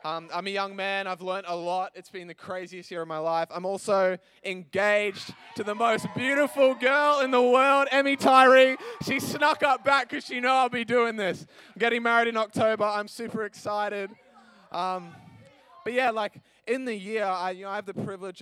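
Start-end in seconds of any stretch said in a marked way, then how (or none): 3.5-14.1 excited gush. Real, not acted. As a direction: proudly announce her name over the cheering crowd. Gushing about fiancée over cheering.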